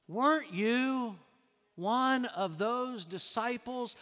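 high, slow, pointed question; servant girl's challenge. Retold or imitated. Servant girl eye to eye with Peter, slow pointed question.

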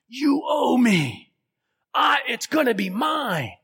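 high, loud threatening snarl; a greedy servant roaring at his debtor; menacing demand. Acted. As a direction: grab him and demand payment.

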